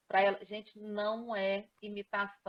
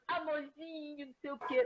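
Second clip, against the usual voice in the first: put-on sweet talk to boyfriend; 5 semitones higher, 3 dB quieter than usual. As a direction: tease with a sugary pet-name voice. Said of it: "Você vai falar com o namorado".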